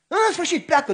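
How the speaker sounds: usual speaking voice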